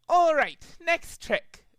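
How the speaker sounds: high, loud stage announcer; showman Trixie. A narrator's reading. Trixie on the microphone hyping the crowd, high and loud. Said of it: "said the Trixie on the microphone".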